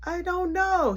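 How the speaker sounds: put-on little kid voice. Little brother answering grandma, high scared kid voice.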